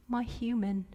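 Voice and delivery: near-whispered small reply; subdued child. Retold or imitated. Daughter answering mother after her tantrum, hushed and subdued. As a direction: imitate a child gone very quiet.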